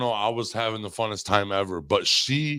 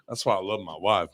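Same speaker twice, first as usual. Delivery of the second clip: normal talking voice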